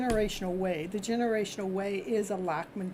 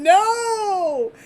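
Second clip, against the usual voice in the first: loud laughing mock protest; a high, laughing shout of no; 11 semitones higher, 13 dB louder than usual. Reader to client, imitating her refusal: loud laughing no. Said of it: "you both are going".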